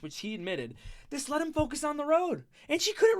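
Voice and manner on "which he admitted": normal talking voice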